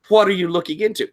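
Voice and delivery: high, quick quizzing voice; inquisitive librarian. Acted. Occult librarian quizzing a library visitor, high and inquisitive. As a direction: play a nosy, eager occult librarian. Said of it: "he is always like".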